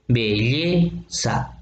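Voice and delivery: "belleza" drawn out syllable by syllable, leaning on the "lle"; stressed-syllable demo. Shown as a demonstration. Teacher showing students the accented vowel, "belleza" split slowly on "lle". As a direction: say "belleza" very slowly, stretching the stressed e, then "za" apart. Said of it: "Esta E es la vocal acentuada".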